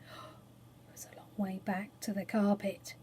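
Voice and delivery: hushed, breathless whisper. A narrator's reading. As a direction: whisper it with dread. Narrator drops to hushed dread.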